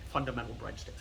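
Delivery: low, soft Scottish-accent attempt; a quiet, rough stab at a Scottish accent; Sean Connery. Joking impression that drifts off target, mock Scottish accent. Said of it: "That's more Sean Connery".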